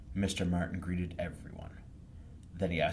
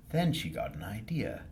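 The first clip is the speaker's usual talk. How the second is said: ordinary delivery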